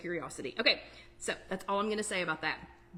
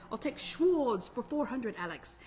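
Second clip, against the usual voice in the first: Sean Connery impression; 3 semitones higher than usual. Telling the group about a Jeopardy skit, imitated Scottish accent. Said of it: "I can't do the accent, but it's a deep, you know, kind of a Scottish accent".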